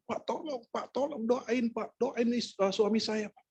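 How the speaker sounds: high-pitched but soft imitation of screamed pleading; small, high mock-desperate wail; mild mock shrieking. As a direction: act out a wife's frantic begging without volume. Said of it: "ibunya tuh menjerit-jerit".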